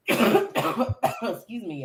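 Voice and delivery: slow cough rolling into a rough excuse me; cough then apology. Real, not acted. Guest choking on air mid-sentence, coughs then apologizes. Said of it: "I'm in here choking".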